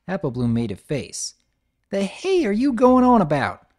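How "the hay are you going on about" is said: annoyed country filly. Acted. Applebloom annoyed.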